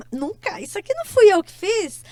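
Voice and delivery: acted-out astonished denial; retold disbelief. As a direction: marvel at your own doing. Retold amazement at own work.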